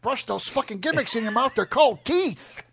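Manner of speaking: loud, punchy wrestler imitation; Stone Cold impression. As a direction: build to a high, loud last word. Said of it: "Stone Cold telling you how to".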